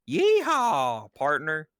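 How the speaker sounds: high, loud cowboy whoop; cowboy yeehaw. Retold or imitated. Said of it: "That's what she sounds like".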